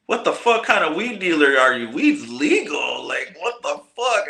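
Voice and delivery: high, incredulous scolding; mock exasperation climbing in pitch; exasperated disbelief. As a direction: imitate a lawman who can't believe his catch. Acting out marshal berating a weed dealer.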